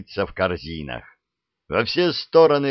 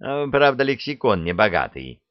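ordinary delivery

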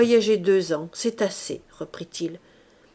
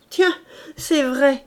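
exclaimed; 5 semitones higher, 5 dB louder, slower than usual. A narrator's reading. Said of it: "s'écria l'architecte".

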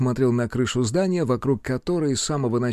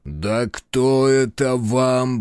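demon voice; much slower than usual. Acted. Monster outraged at the blow.

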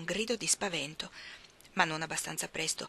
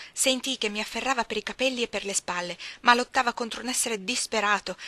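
ordinary delivery, nothing marked

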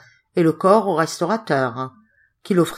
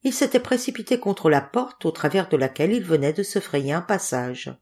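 usual speaking voice

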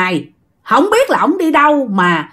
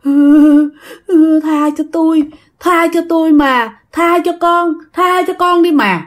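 moaning plea; 5 semitones higher, slower than usual. Retold or imitated. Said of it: "mà ổng rên".

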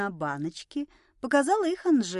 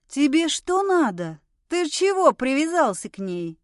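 higher, louder challenge; confrontational; 5 semitones higher, 4 dB louder than usual. A narrator's reading. Woman pushing a drunk off her friend, confrontational. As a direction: demand an answer crossly.

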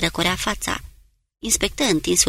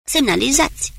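higher, louder shouted order; shouting; 6 semitones higher, 3 dB louder than usual. Acted. Captain shouts signal order from the deck, raised shout. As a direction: call it over the rail to the bow. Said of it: "Marshall strigă".

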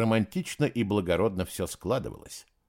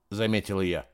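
ordinary delivery

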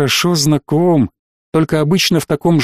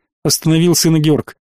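plain reading voice

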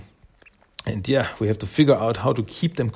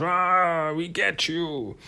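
angry monster growl; 6 semitones higher, 4 dB quieter, much slower than usual. Retold or imitated. Tokka enraged as he punches Raph, a slow growl. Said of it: "he gets really angry".

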